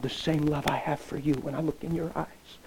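soft breathy whisper; tender whisper. Retold or imitated. Pastor whispering God's love to the girl. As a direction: breathe it to her gently.

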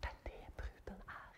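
whispered, soft and intimate. Real, not acted. Preacher whispering to the congregation. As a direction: breathe the words out on no voice. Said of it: "repeated as whisper".

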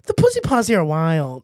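a loud Brooklyn street voice; lightly put-on Brooklyn accent; mock street interview. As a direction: play a guy on the street. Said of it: "Let's ask the street".

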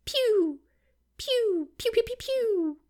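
high squeaky pew pew; laser pew sounds. Retold or imitated. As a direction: zap noises, cartoon laser. Imitated laser shots.

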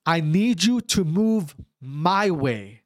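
breaking it down. Retold or imitated. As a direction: imitate a coach making it simple. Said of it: "He literally was breaking it".

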